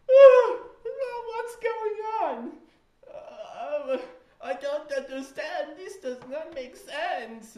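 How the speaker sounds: put-on loud, very high British boy wail, slipping German; botched British-boy accent. British boy freaking out over keys, high botched-British wail. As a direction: shriek like a baffled child in a bad British accent. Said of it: "I sound more like a German boy".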